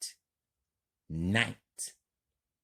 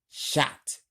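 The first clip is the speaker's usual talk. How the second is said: ordinary delivery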